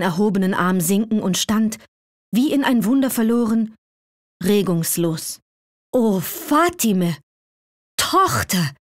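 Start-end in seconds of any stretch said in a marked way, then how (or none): 5.9-8.7 higher, slow, amazed call to his daughter; overjoyed cry. A narrator's reading. Sultan seeing his lost daughter again, calling out in joy. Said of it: "rief der Sultan aus".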